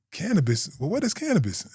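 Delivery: put-on high, puzzled old-lady voice; grandma who has never heard the word; a grandmother squeaking up in confusion.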